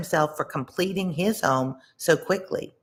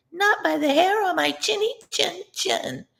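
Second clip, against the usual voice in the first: much higher, tiny pig voice; high squeaky piglet. A narrator's reading. First little pig squeaks back at the wolf, high and tiny. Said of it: "said the first little pig".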